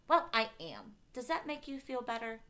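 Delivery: high, loud comeback; angry retort. A narrator's reading. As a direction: throw it back at him.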